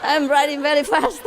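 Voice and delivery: repeating the line through laughter; amused echo of the punchline; laughing repeat. Retold or imitated. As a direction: giggle the words out. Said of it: "repeats punchline laughing".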